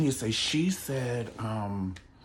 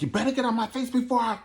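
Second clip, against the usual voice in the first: mock threat; faster than usual. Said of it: "Kandi was like".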